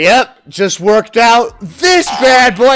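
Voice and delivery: loud, high mock brag; slow, drawn-out hammed-up boast; boastful gym bro. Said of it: "He's like".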